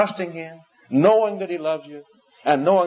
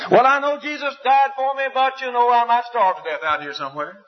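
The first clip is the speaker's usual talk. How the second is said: mock fretful doubter; 9 semitones higher than usual. Mocking a doubter's worried objection.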